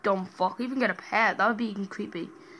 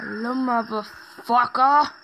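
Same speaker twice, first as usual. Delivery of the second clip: slow, drawn-out, louder mock monster greeting; mimicking Slenderman; 3 semitones higher, 7 dB louder, slower than usual. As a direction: stretch the words slowly like a looming stalker. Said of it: "he's always, like, just like".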